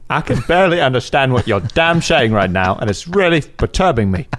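light Cockney film star voice; Michael Caine voice. Pretend. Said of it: "your Michael Caine".

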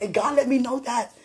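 ordinary talk, nothing marked

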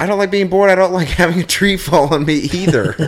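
loud, high, laughing protest; indignant retort. Real, not acted. Host retorting about falling trees, loud mock indignation. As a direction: fire back loudly, amused.

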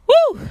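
high, loud whoop of exasperation; exasperated "woo". Real, not acted. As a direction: blow off steam in a high woo. Exasperated outburst while retelling.

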